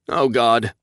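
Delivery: ordinary delivery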